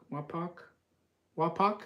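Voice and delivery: "Wapak" ending like pock, rising; tentative pronunciation. Shown as a demonstration. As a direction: try "Wapak" with an open ah, as a question. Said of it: "is pronounced Wa".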